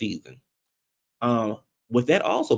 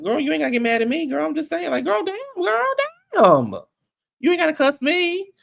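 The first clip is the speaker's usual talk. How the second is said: put-on high, fast, exasperated "girl, damn"; sassy Sutton impression. Vlogger imitates Sutton telling Dorit not to cuss her, high and sassy. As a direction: mimic Sutton brushing off Dorit, pitched way up.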